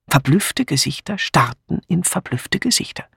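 usual speaking voice

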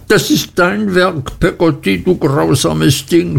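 hoch und schrill vorwurfsvoll gerufen; aufgebrachter Schrei. A narrator's reading. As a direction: eine Mutter, die ihre Magd anschreit. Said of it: "schrie meine Mutter". (Mutter schreit Peggotty vorwurfsvoll an)

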